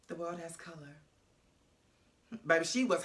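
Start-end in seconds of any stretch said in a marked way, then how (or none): 0.1-1.0 dreamy sigh. Retold or imitated. Pulane dreamy after first time.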